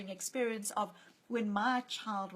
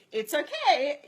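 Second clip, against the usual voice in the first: louder, higher brushing-off "It's okay"; dismissive reassurance; 6 semitones higher, 10 dB louder, slower than usual. Retold or imitated. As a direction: shrug off someone's upset. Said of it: "someone comes to us and says".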